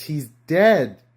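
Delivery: ordinary talk, nothing marked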